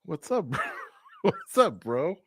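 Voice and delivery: imitating the fighter while cracking up; laughing through the quote. Retelling the dog greeting to his cohost, laughing. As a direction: barely get the words out for laughing. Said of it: "He goes".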